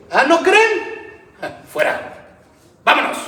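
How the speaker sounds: high, slowed scornful retort; Jesus rebuking scoffers. Acted. Jesus dismissing the mourners who laughed, scornful. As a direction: toss the question back at them.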